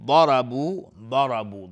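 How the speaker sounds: ضربوا with a thick, heavy ض, said loudly; heavy dad. Shown as a demonstration. Teacher showing the heavy dad to students. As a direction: give ضربوا a full-mouthed heavy ض. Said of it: "ضاد از حروف استعلاء غلیظ".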